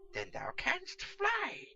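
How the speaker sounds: high, slow, loud longing exclamation; envious wonder. Acted. Catweasel envies Pickle's flight, high slow loud wonder. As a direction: marvel with envy. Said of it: "quite envious".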